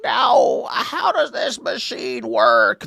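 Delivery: put-on high, slow old-timer voice; hammed-up old person fumbling with a machine; confused elderly worker. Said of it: "when I'm her age. I'll be like".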